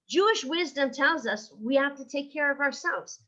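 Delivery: ordinary talk, nothing marked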